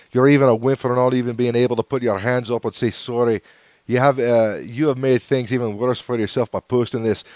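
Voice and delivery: loud, mock Scottish rant at the troll; Scottish accent. Shown as a demonstration. Reading a viewer's hate comment in a Scottish accent. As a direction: read the comment in a hammed-up Scottish brogue. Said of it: "if I do it in the Scottish accent".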